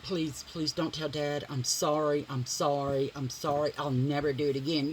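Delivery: mock a cowering husband begging; mock pleading apology.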